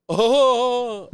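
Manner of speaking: long, loud, high "ohhh"; mock reaction "oh". Stranger hearing the complaint, exaggerated ohhh. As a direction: imitate an exaggerated drawn-out reaction.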